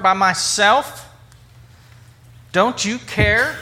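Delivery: mildly frantic, insistent plea rising on CARE; acted exasperation, drawing out the demand; frantic Martha. Upset Martha complaining to Jesus about her sister, mildly frantic. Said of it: "Martha is frantic".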